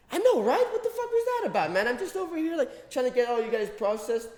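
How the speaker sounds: high, fast mock cop voice; exasperated cop. Cop venting to the speaker about the jackass, high and fast. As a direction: squeak it out like a fed-up cop.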